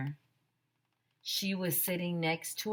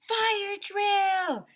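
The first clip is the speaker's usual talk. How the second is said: put-on high, loud kids' cheer; excited shout; 6 dB louder than usual. Kids shouting at the fire siren, excited yell.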